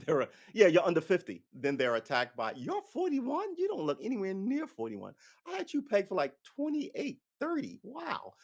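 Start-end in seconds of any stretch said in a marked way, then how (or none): 2.6-8.3 squealing fake surprise at someone's age; mock amazed flattery. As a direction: fawn over the age like it's unbelievable.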